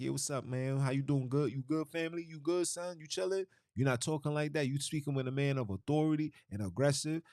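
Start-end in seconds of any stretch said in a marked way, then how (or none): 0.0-3.5 high, breezy mock street slang; casual street greeting. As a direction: toss off a loose, friendly hey-man greeting. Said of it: "you not saying things like".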